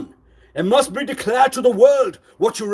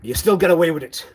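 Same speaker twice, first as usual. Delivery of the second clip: ordinary talk, nothing marked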